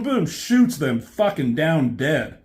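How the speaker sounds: ordinary delivery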